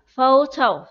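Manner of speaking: Photo, PHO loud with a falling tune; stress on first syllable. Shown as a demonstration. Teacher shows pupils the stress, PHO stressed. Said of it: "Trọng âm ở đầu tiên".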